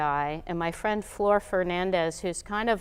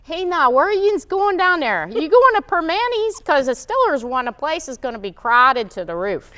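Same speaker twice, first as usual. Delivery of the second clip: put-on broad Pittsburgh accent, pitched high and loud; a hammed-up Pittsburgh local calling out; thick Yinzer Pittsburgh accent; 9 semitones higher, 8 dB louder than usual. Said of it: "give you the simple Pittsburgh accent".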